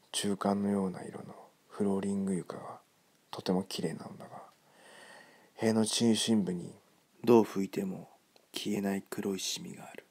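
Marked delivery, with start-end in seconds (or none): none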